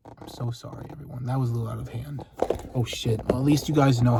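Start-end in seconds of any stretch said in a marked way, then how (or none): none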